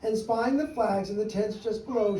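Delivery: usual speaking voice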